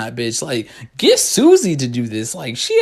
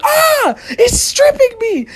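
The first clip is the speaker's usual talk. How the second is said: very high, loud panicked scream; 4 dB louder, much slower than usual. Retold or imitated. Boy screaming as the dog pulls his shorts down, panicked.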